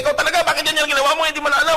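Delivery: high, fast angry nagging; angry scolding. Acted. Angry person scolding you with high fast nagging. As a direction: scold like an irate neighbour.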